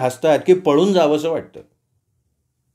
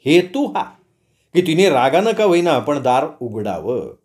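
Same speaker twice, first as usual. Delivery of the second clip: ordinary delivery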